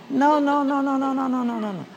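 high string of no's, fading away; dismissive rapid repetition. Acted. Preacher correcting an imagined frantic base runner, dismissively.

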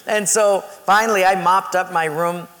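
ordinary talk

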